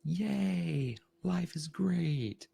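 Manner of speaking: very high, drawn-out, over-happy cheer; hammed-up sing-out of forced positivity; mock cheeriness. People cheering at someone who feels blue, hammed-up happy. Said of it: "people are like".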